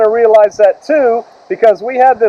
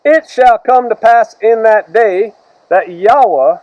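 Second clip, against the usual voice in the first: scripture reading; 2 semitones higher, slower than usual. Acted. Reads scripture aloud.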